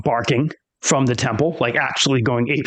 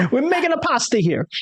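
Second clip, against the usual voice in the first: high-pitched mock Italian accent; playful Italian caricature; 12 semitones higher than usual. Shown as a demonstration. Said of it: "I wanna have Italian lunars eventually".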